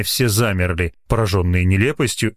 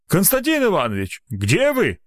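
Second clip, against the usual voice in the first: calling out; 9 semitones higher than usual. A narrator's reading. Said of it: "позвал он".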